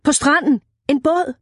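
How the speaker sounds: high urgent calling; shout. A narrator's reading. Tom points Isabel to the boat on the beach, calling. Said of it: "råbte han".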